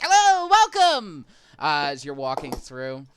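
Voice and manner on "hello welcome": very high, loud, drawn-out hail; little creature's greeting. Acted. Alliamites waving at passing visitors, high shouted greeting. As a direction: call out a welcome in a squeaky little voice. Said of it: "and they're like".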